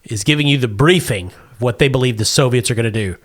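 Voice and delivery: ordinary talk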